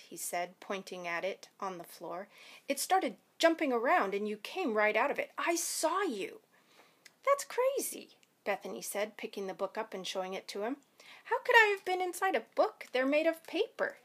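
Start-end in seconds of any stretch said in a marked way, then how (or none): 2.7-6.4 worked-up accusation; agitated insistence. A narrator's reading. Boy insisting on what he saw. 7.2-8.2 very high, scoffing dismissal; incredulous denial. A narrator's reading. Girl denying the accusation. 11.2-14.0 high, loud defensive question; incredulous protest. A narrator's reading. Girl scoffs at the accusation.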